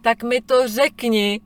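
mock-patient parent voice, dragged out; slow insistent parent. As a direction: spell it out to a whining child. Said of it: "Já vždycky říkám".

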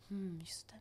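soft, musing "hmm"; hushed moment of realisation; pensive murmur imitation. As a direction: mimic someone thinking to themselves. Imitates classmates thinking during test.

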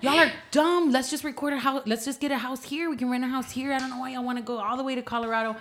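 high-pitched mock outrage, fast at the end; retold mad rant. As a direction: replay your past self whining angrily, racing to finish. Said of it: "I was so mad".

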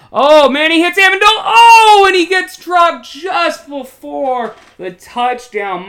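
high, loud play-by-play yell; excited shouting. Real, not acted. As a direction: scream the big play to the crowd. Big catch near the goal line.

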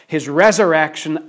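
normal talking voice

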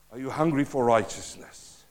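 ordinary delivery

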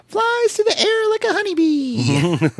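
very high, slow cartoon voice; puppet rabbit voice. Ramblin' Rabbit praises Finn Balor, high puppet voice. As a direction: squeak it out high and drawn out.

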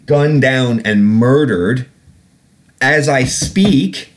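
slow, each word weighed in anger; slow outrage. Real, not acted. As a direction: lay it down slowly, appalled. Angry rant about killings.

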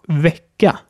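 Wecker as Swedish vecka, short open E. Shown as a demonstration. Teacher models Wecker for learners, short open E. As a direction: say Wecker with the E of Bett and an open ending. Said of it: "das kurze E".

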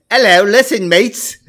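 hammed-up Cockney hello; Cockney accent. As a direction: call out in a put-on Cockney. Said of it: "English accent I do is a Cockney accent".

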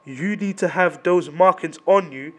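ordinary delivery

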